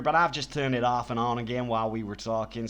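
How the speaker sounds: ordinary delivery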